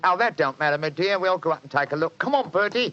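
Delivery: Cockney disguise. Acted. Disguised Holmes fibs to the landlady, Cockney accent. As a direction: bluff the landlady as a Cockney council man. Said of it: "My Cockney accent".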